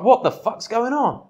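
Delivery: woman rushed by a stranger, confused and startled. Retold or imitated. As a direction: react like someone caught off guard by a stranger. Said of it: "you'd be like".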